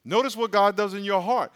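ordinary delivery

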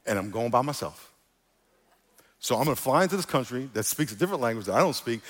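ordinary talk

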